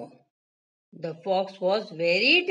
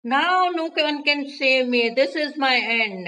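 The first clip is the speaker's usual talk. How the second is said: louder, higher cry of despair; fearful; 4 semitones higher, 3 dB louder than usual. A narrator's reading. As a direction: gingerbread man sure he is doomed. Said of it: "trembled with fear".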